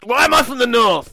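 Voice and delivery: high, hammed-up northern English wrestler; mock northern accent. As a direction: play it loudly in a put-on northern accent. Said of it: "he'd be a northerner".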